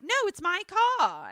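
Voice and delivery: high-pitched mock indignation; indignant protest. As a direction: protest it like a petulant character. Acted quarrel over the car.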